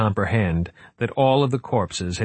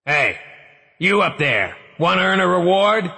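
calling up; 9 semitones higher than usual. Acted. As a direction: shout up to strangers. Calling up to the street.

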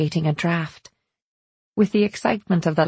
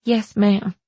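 mildly strained, obedient reply; 3 semitones higher than usual. A narrator's reading. Kitchen girl answering the mistress, strained. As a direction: answer dutifully with a touch of strain. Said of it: "Belle's voice was strained".

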